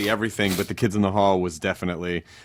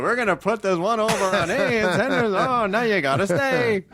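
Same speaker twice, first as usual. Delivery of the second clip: higher, put-on voice slowing at the end; sketch character voice; 10 semitones higher, slower than usual. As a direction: play a smug sketch character. Guest acting out a sketch.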